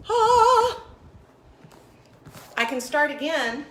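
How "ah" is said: startled cry. Real, not acted. Startled by a tech mishap.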